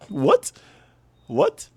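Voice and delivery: high, sudden disbelief; shocked what. Real, not acted. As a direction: pop it up in shock. Shocked at player's age.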